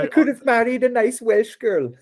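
laughing high mock Welsh lilt; mock Welsh accent. Laughing at the quote, a bad mock Welsh accent. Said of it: "The Welsh accent is something I will never be able to master".

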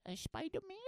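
put-on little girl voice. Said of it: "she goes".

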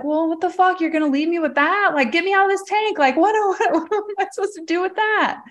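high, incredulous outburst at her dead father; squeaky high, can't believe he left it there; high frantic what the fuck; exasperated disbelief. Retold or imitated. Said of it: "And I was like".

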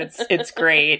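usual speaking voice

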